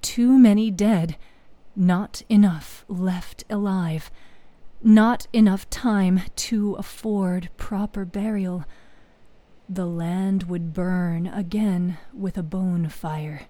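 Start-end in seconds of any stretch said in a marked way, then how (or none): none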